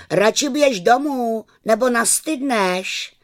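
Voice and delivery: put-on high, carrying boyish call. A narrator's reading. Pepík urges wet Kája to go home, high calling. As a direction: call the warning across the ice. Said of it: "Pepík Hervíkovec volal".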